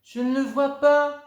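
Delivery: very high and loud; straining to spot it; puzzled girl. Acted. Girl searches tree. (fillette perplexe, très aiguë)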